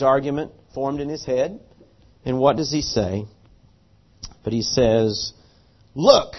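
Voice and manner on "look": correcting tone. Retold or imitated. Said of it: "he's gonna correct his father here".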